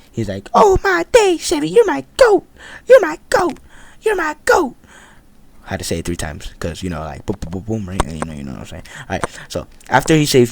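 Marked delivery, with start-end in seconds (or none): 0.5-4.7 squeaky high, louder pleading whine; mock crying friend. Mocking his friend thanking Sebi for saving him, in a squeaky high whine. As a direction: whine like a rescued friend begging in gratitude. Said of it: "he is crying for his life".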